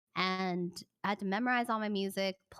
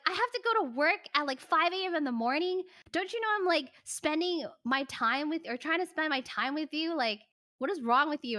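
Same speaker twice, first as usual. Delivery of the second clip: higher, louder, fast imitation of an irritated boyfriend; mock angry rant; 6 semitones higher, 4 dB louder than usual. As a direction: rattle off his complaints in a hammed-up huff.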